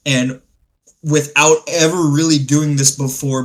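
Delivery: usual speaking voice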